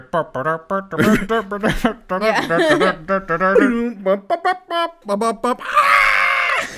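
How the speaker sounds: high, loud rat-a-tat of guns, laughing through it; imitated cannon fire rattle. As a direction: spit out a burst of mock gunfire. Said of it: "you hear".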